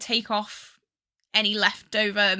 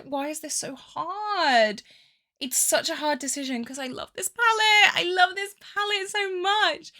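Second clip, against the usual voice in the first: mock despair; 7 semitones higher than usual. Agonising over cutting a palette.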